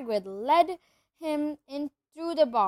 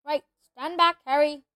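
louder, raised warning; firm call; 3 semitones higher, 8 dB louder than usual. A narrator's reading. Hagrid telling Harry to stand back, louder. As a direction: clear him out of the way.

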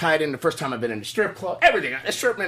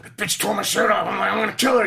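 mock angry outburst; 7 semitones higher, 5 dB louder than usual. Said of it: "I go".

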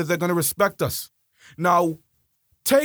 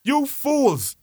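higher scornful "fools"; mocking dismissal; laughing scorn; 8 semitones higher than usual. Real, not acted. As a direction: sneer it again, amused. Repeat after laugh.

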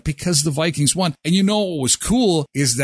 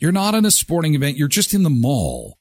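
ordinary talk

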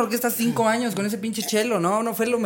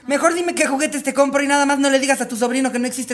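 mom voice; 7 semitones higher than usual. Retold or imitated. Mom answers the kid in the taxi.

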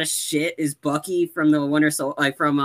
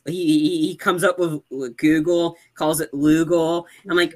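usual speaking voice

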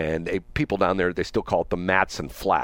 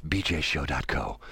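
lower, softer smooth radio announcer; public radio host; 4 semitones lower, 4 dB quieter than usual. Acted. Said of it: "nobody can beat my public radio voice".

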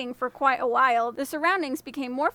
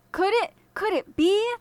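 higher, hopeful and disbelieving; can it be, stunned hope; amazed exclaim; 7 semitones higher than usual. Acted. The farmer hearing his lost ox bellow, amazed. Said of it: "the man exclaimed".

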